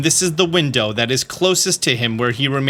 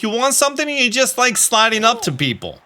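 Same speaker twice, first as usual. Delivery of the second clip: a hint of a young couple fretting in a Staten Island accent; lightly voiced mob lovers; 7 semitones higher than usual. Runaway daughter and her boyfriend fret, lightly voiced. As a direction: play the runaway couple, a bit higher.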